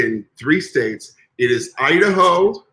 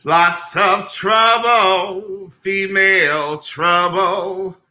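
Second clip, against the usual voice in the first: slow, drawn-out campy movie voice; Taffy Davenport impression; 5 semitones higher, much slower than usual. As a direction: drag it out like a camp diva. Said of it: "Taffy Davenport".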